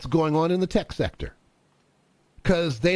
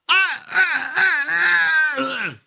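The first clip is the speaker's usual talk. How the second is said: heart attack gasps; 8 semitones higher, 4 dB louder, slower than usual. Acted. Plumber has a heart attack.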